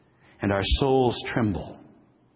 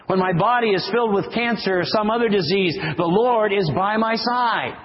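higher litany with Lord on every refrain; preaching at full force; 7 semitones higher than usual. Acted. Preacher lifting the congregation through each trouble, full force.

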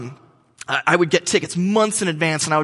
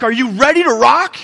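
higher, louder rallying shout; rock hype; 7 semitones higher, 6 dB louder than usual. Retold or imitated. Opener hyping the crowd at the gates. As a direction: fire up the crowd. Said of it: "the guy's like".

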